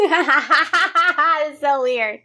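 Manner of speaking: high, slow words through real laughter; drawn-out laughing complaint. Real, not acted. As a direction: wheeze it out while cracking up. Cracks up at vomit sounds.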